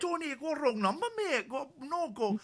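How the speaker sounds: very high comic mimic voice; high-pitched comic impression. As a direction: put on a high voice to shoo the caller off. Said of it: "You're not doing a bad impression".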